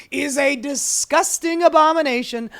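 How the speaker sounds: hammed-up outraged denunciation; Elon Musk. Host overdoing billionaire's outburst, indignant and Trump-like. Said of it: "trying not to give him a Donald Trump accent".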